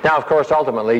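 ordinary talk

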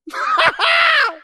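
loud, high burst of laughter; joyful shouted laugh. Real, not acted. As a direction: let out a big joyful whoop of a laugh. Joyful laugh after climax.

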